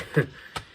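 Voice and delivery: brief, light self-conscious laugh; small chuckle after a doubtful pronunciation; short chuckle. Real, not acted. Chuckles at own pronunciation attempt.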